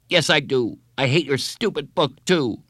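put-on high, heated child's retort; angry insistence. A narrator's reading. Boy insists he means his hatred, angry.

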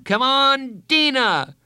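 high, loud shout. Acted. Bradley cheering Judy on, loud shout. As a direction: outshout the whole team. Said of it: "shouted louder than anyone".

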